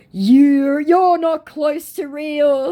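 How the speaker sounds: high, slow put-on insult; scammer's insult mocked high and drawn out. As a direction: stretch it out high and mocking. Scammer reply voiced.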